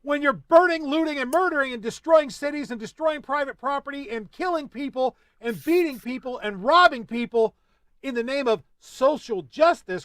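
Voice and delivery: high, loud furious listing; angry rant. Real, not acted. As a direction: build a furious tirade. Angry rant about rioters.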